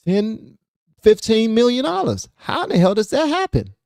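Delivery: very high-pitched, baffled disbelief; incredulous outrage. Real, not acted. Host questioning politicians' wealth to viewers, incredulous. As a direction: demand an explanation, pitch soaring.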